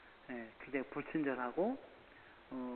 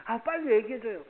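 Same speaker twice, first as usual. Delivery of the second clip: eager pleading; 7 semitones higher, 7 dB louder than usual. Pretend. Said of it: "애달복달하게".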